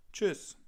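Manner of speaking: Tschüss said high, the German way with a rounded ü. Shown as a demonstration. Teacher modelling Tschüss to learners, high and crisp. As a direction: pitch up and say Tschüss with a crisp tsch and short ü. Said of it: "It's like bye".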